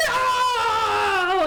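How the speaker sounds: loud high mock wail of no; anguished no. Glenn mourns the prime minister, loud anguished no. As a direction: cry out over the dead leader.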